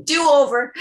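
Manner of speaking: high, loud playful call; sitting in the car, calling a do-over. Retold or imitated. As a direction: call it out like a kid's game. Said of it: "I'll go".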